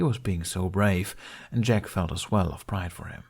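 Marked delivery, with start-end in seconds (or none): none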